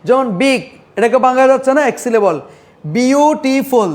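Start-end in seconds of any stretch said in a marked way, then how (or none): 2.8-3.9 beautiful slowly parted as বিউ, টি, ফুল; measured, piece-by-piece beautiful; broken into syllables. Shown as a demonstration. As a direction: pull beautiful apart into three beats. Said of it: "তিনবার ভাঙতে পারি".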